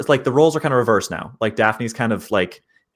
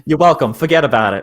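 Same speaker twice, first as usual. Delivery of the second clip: put-on New York accent; 4 semitones higher, 6 dB louder than usual. Answers accent remark in character.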